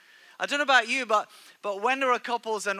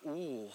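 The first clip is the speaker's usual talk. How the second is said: women's soft wince; 6 semitones lower, 13 dB quieter than usual. Retold or imitated. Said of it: "all the women go".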